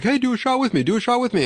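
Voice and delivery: high-pitched pestering party voice; imitated eager drinkers. Mimicking guests pressing him to drink, high eager voice. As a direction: mimic partygoers begging, well above your own pitch.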